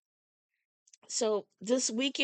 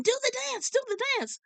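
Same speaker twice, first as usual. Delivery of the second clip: much higher, excited chant; yelling excitedly; faster than usual. Retold or imitated. Fan lady urging her to dance, excited high call. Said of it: "she started yelling".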